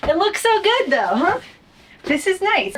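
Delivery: excited. Real, not acted. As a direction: gush over the new build. Admiring finished bed storage.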